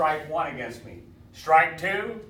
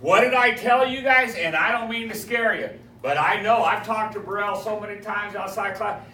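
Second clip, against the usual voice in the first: ordinary talk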